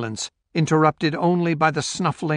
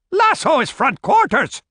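high-pitched shouted order; shouting; 12 semitones higher, 4 dB louder than usual. A narrator's reading. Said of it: "shouted Grant".

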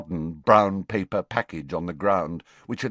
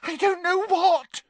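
sobbing. Acted. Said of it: "sobbed Leanne".

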